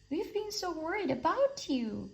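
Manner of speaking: high, worried duck voice; a hint of a fretful duck; anxious cry. A narrator's reading. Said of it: "cried Duck".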